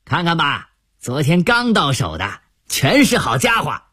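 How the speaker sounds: high, wheedling hawker voice; peddler calling out. Acted. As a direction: call out like a street peddler. Peddler hawking at the door.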